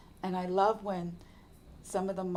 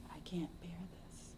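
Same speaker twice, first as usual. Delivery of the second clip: breathy whisper of I can't bear this; whispered despair; 8 dB quieter than usual. Retold or imitated. Retelling her hospital despair to listeners, a hushed whisper. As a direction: barely voice it, worn out.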